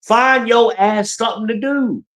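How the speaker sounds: high, slow, exasperated sit fucking still; a half-shouted order at the fidgety neighbors; exasperated outburst. Retold or imitated. To the neighbors coming and going, high exasperated scolding. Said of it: "I'm like".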